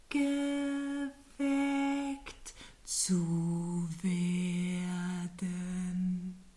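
very slow, low snail drawl. Acted. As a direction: drag every word out lazily. Snail voice held.

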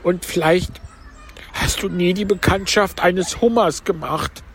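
high, plaintive turtle voice; Mock Turtle. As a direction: pitch it up for the tearful turtle. (hohe Stimme der falschen Schildkröte)

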